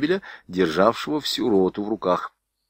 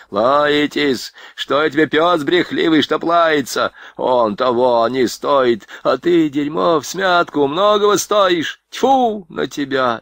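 higher, louder scornful tirade, slowing at the end; the sergeant scorning Rodionov before leaving; indignant insults; 6 semitones higher, 3 dB louder, slower than usual. A narrator's reading. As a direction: spit out the insults with contempt.

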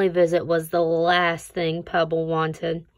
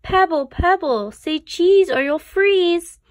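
cheerful photographer, loud and high; photographer's call; 10 semitones higher, 7 dB louder than usual. Acted. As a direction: photographer coaxing a smile, loud. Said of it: "said the photographer".

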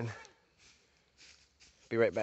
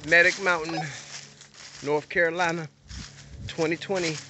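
announcing for camera; 3 semitones higher than usual. Acted. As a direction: announce it like a show host.

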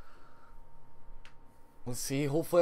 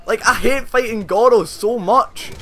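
exasperated; 6 semitones higher, 7 dB louder, slower than usual. Real, not acted. Complaining about Goro.

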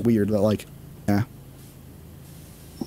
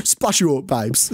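mock British, "babes" drawn toward "bibes"; playful British slang voice, saying "babes" as "bibes"; British "babes" accent; slower than usual. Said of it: "the babes".

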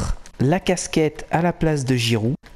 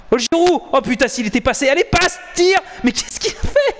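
excited yell; 10 semitones higher than usual. Real, not acted. Big chance missed.

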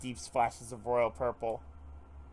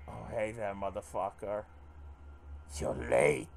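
evil Tommy voice; 5 semitones lower, 4 dB quieter than usual. Acted. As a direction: menace them softly like a killer. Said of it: "This is an evil, evil Tommy voice".

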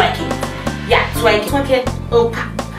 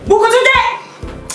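sudden high slow shout; 11 semitones higher, slower than usual. Acted. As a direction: yell it out suddenly. Sudden shout after quiet take.

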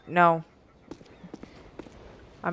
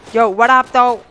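hype shout; 6 semitones higher, 9 dB louder than usual. Real, not acted. Gamer catchphrase called out mid-fight.

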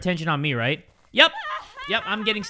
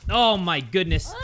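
ordinary delivery

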